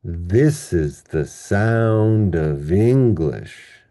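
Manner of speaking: very slow, stretched THIS, SOUND and ENG in "This is the sound of English"; overdone slow demo stressing this, sound and the start of English; exaggerated sentence stress. Shown as a demonstration. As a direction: draw out the stressed beats THIS, SOUND, ENG-lish hugely. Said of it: "That's sentence level word stress".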